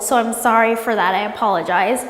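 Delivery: ordinary talk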